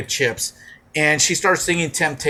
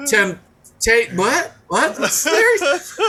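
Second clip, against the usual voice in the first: baffled crowd; 5 semitones higher, much slower than usual. Retold or imitated. Crowd baffled by unknown lyrics.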